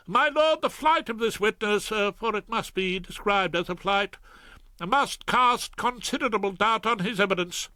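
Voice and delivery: high, anxious prosecutor; apologetic Ballard. Acted. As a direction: admit defeat uneasily. Ballard voiced.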